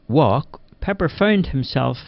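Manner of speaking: usual speaking voice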